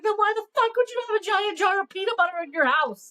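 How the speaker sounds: fast, high-pitched mock outrage; retold indignant confrontation. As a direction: fire off an incredulous challenge. Retold angry confrontation over peanut butter.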